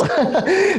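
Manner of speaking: laughing. Real, not acted. Laughs at guest's remark.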